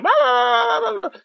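high, loud babbling din; hammed-up yelling and barking noises; imitated household racket. Mimicking the noise in his friend's house, shrill mock yelling. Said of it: "is kids yelling, dogs barking, women screaming".

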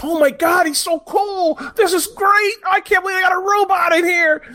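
high, gushing fan imitation; mock amazement. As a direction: squeal it like an awestruck onlooker. Acting out an amazed onlooker.

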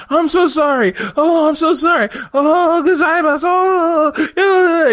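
very high, loud sobbing apology; hammed-up wailing apology. Hired actor begging the bosses, hammed-up wailing. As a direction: wail your sorry like a broken man. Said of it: "John Smith starts apologizing".

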